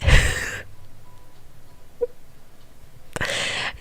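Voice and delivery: breathless wheezing laugh; stifled laughing fit. Real, not acted. Player hearing he wants someone athletic, stifled laughing. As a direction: crack up, keeping it hushed.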